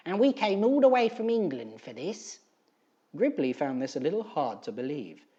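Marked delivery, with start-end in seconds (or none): 0.1-2.4 high gloating hag voice, a bit louder; grinning old crone. Acted. Edith boasting to Gribley, a high gloating crone. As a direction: crow it like a smug old woman. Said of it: "grinned Edith".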